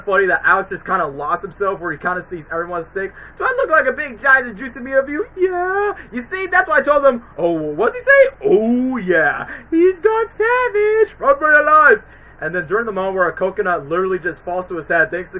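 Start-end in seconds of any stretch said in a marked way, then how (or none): 3.4-12.1 voiced lemur chatter. Fan acts the lemur panic, lightly voiced. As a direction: play the scared lemurs. Acted lion and lemur dialogue.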